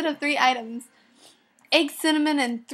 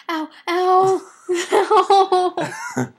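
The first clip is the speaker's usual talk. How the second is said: mock yelps; 6 semitones higher, 7 dB louder, much slower than usual. Yelping in pain, laughing.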